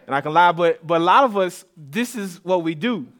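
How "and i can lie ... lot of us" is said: usual speaking voice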